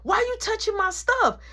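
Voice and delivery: high, heated accusation; angry. Retold or imitated. Uncle confronts nana, high and angry. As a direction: accuse her with a high temper. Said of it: "He like".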